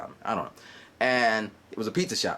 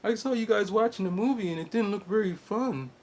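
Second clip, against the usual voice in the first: put-on 1 other girl; 9 semitones higher than usual. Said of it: "she's just like".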